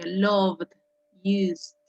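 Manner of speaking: "loved, used" each closed with a voiced D. Shown as a demonstration. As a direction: model a final D on "loved, used", separately. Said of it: "Termina como una D todas".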